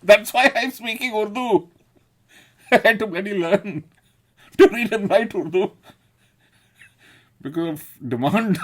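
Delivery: high, loud and laughing through the words; laughing loudly. Real, not acted. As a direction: crack up while telling the punchline. Laughing at his own story.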